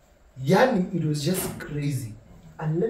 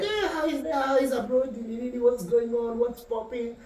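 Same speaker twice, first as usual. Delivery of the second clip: bright, much higher hyped-up hello; cheery greeting; 7 semitones higher than usual. Retold or imitated. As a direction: greet him like an old buddy. Retold cheery greeting to guy.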